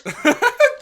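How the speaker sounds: high, loud squealing giggle; high squealing laugh. Real, not acted.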